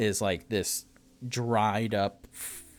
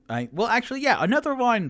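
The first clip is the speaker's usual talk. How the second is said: high squeaky voice; 9 dB louder, faster than usual. Acted. High loud order.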